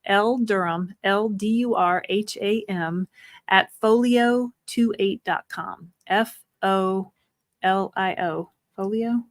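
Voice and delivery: slow, measured spelling-out; nice and slow. Real, not acted. Host gives listeners an email address to write down, nice and slow. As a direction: spell it slowly for someone writing. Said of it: "I'm gonna say it nice and slow".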